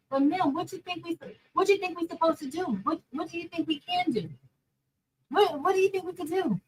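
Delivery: high, pleading, fed-up questions; exasperated rant. Retold or imitated. Teacher venting to her audience about the lady, exasperated and high. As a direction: throw the questions at her in disbelief.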